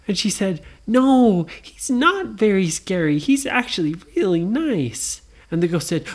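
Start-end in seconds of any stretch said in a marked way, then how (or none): 0.9-5.2 insistent Nora. Acted. Nora contradicts the ghost.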